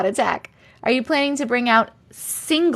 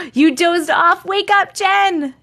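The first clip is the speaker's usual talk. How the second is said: high, raised mock alarm; pitched-up, louder teasing shout; playful wake-up call; 7 semitones higher, 4 dB louder, slower than usual. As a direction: call out to rouse a dozer. Calling out to a sleepy viewer.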